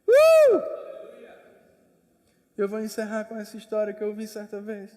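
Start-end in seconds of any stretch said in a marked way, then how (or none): 0.1-0.6 high loud exclamation "uh"; a sharp, high burst of a cry; sudden whoop. Acted. Preacher whoops to stir the church, high and loud.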